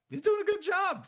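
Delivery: won-over surprise. Retold or imitated. Host recalls warming to the actor, pleasantly surprised. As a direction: lift it right up in impressed surprise.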